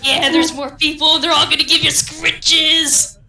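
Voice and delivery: loud, gushing praise to a dog; loud excited dog-talk. Real, not acted. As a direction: gush over the dog.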